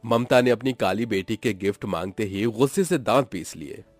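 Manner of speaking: plain reading voice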